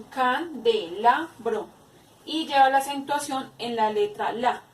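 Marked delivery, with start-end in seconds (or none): 0.0-1.7 CANDELABRO slowly, broken into can, de, la, bro; can... de... la... bro, with small gaps; split into syllables. Shown as a demonstration. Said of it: "se divide en".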